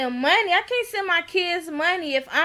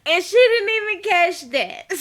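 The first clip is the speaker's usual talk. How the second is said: laughing; 4 semitones higher, 4 dB louder than usual. Real, not acted. Laughing at the show.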